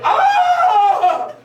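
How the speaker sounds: ordinary delivery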